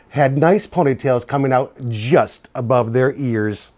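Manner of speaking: lively picture-book read-aloud; reading to children. A narrator's reading. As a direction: tell it to a class of kids.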